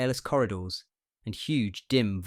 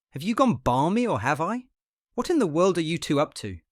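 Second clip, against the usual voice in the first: higher, louder furious outburst; 7 semitones higher, 5 dB louder than usual. A narrator's reading. Patient rails at friend and nurse, a furious outburst. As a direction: rail at them in fury. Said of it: "His fury tired him".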